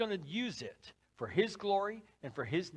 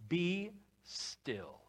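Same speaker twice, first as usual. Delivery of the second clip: softer, hushed and slow; calm hush; 6 dB quieter than usual. Acted. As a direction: ease them into stillness. Preacher calming the listeners.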